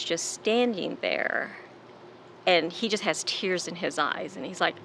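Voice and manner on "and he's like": ordinary talk, nothing marked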